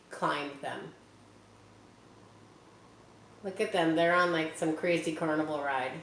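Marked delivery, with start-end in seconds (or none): none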